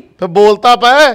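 slow, high, loud prod; shouted slow nudge; impatient shout. Acted. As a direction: drag it out and yell it. Father urging on phone.

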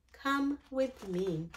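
soft refrain. A narrator's reading. Refrain between story pages.

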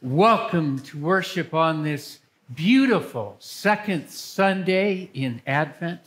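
normal talking voice

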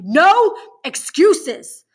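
very loud, high, drawn-out shout; explosive angry command; roaring. A narrator's reading. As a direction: cut them off with a slow roar. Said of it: "The warden roars so loud".

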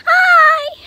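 high squeaky Chica voice; Chica character. Pretend. Bonnie has Chica greet the viewers, high squeaky character voice. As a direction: pipe up loud and very high. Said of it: "Chica, say hi".

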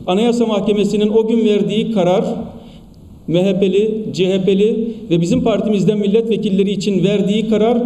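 ordinary delivery

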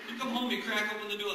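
ordinary delivery

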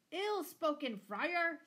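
high, very loud angry retort; thundered. A narrator's reading. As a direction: thunder back at the friar. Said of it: "thundered Lord Fitzwalter".

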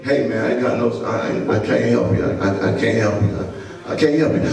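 deep, quick I can't help you; refusing friend. Retold or imitated. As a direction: play the friend who won't lend a cent, low and dismissive. Friend refusing to lend money.

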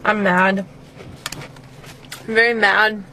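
talking with food in mouth; speaking between bites; chewing while talking. Real, not acted. Seen 0: mouth working, as if chewing, from -5; holds pretzel piece throughout.

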